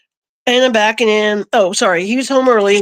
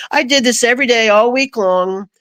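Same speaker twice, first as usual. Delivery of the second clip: ordinary talk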